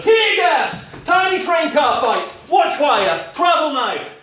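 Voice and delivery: shouting gadget names mid-fight; announcing shouts. Acted. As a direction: call each gadget out high and loud.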